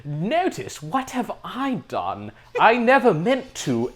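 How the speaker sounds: slow, hammed-up English accent; Henry Thomas's bad accent. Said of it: "as bad as his accent was".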